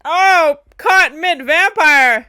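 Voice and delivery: loud, high, stretched-out exclamation; shocked excitement. Real, not acted. Viewer seeing someone caught mid-vampire, shocked excitement. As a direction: burst out at the sudden catch. Reacting to sudden action.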